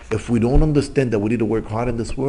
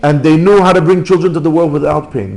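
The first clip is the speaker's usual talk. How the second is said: loud, raised sermon voice; preaching at full force; 7 semitones higher, 9 dB louder than usual. Acted. Rabbi drives home his point about painless birth, loud preaching. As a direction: hammer the point home from the pulpit.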